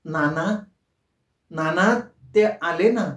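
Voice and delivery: stagey, sing-song call, rising; dramatic theatrical tone. A narrator's reading. Kusum teasing her father in a stagey voice. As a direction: play it like a stage heroine. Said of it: "नाटकी स्वरात म्हणाली".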